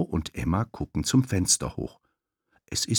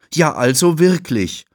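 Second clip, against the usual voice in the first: indignant; 8 semitones higher, 7 dB louder than usual. Acted. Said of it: "sagt Thabo".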